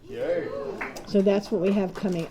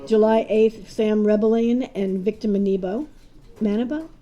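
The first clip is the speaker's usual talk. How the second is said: ordinary delivery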